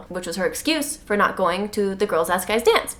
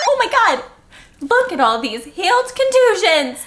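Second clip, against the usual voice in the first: mock amazement; 8 semitones higher than usual. Mocking Bella's concussion.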